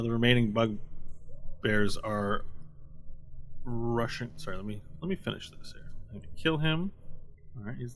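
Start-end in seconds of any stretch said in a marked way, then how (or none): none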